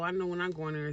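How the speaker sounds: ordinary talk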